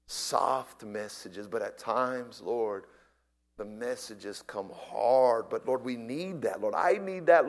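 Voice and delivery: closing sermon prayer. Real, not acted. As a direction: pray for a softened heart.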